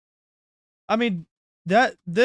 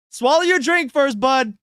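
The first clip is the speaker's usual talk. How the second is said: amused loud; 6 semitones higher, 6 dB louder than usual. Real, not acted. Teasing friend mid-laughter.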